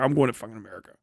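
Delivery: low, soft quoted aside; fed-up mutter. Retold or imitated. Fed-up emigrant announces a move to America, muttered. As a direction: imitate an emigrant muttering their decision.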